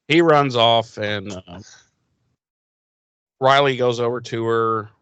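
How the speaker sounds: ordinary delivery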